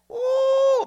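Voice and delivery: loud, high drawn-out whoop; excited "ooh". Real, not acted. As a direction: let out a long thrilled "ooh". Exclaims with excitement.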